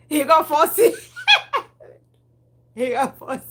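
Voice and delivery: loud giggly laughing aside. Real, not acted. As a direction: laugh through it, amused. Laughing about baby.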